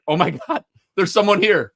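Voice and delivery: excited discovery. Retold or imitated. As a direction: retell it as a thrilled, startled exclamation. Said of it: "he's like".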